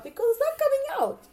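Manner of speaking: very high, fast, loud lament; high-pitched fannish protest; despairing outburst. Retold or imitated. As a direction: wail it out in mock despair. Lamenting that the show is over.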